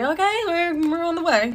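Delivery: hesitant, high-pitched polite reply; imitated baffled driver. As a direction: humour a passenger you don't understand. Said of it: "He has no idea what the fuck".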